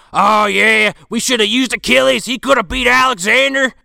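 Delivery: high, loud sarcastic rant; Tex flipping out. Retold or imitated. Tex flipping out on the commissioner, high and loud. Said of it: "Tex ... saying".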